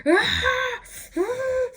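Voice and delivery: high, loud cries of pain; sharp yelps of pain; pained cry. Retold or imitated. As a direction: cry out as if hit by a contraction. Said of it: "And you're like".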